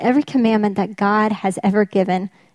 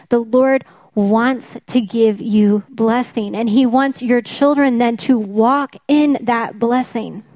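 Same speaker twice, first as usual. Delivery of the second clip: ordinary delivery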